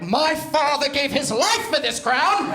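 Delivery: high, loud, slow hammed-up royal proclamation; Medieval Times herald. Teen prom king proclaiming to strangers at the shore, high and grandly hammed. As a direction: declaim it like a Medieval Times knight. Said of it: "like I worked at Medieval Times".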